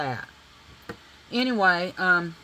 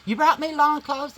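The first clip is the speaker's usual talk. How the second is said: surprised husband; 9 semitones higher, 7 dB louder than usual. Retold or imitated. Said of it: "He goes".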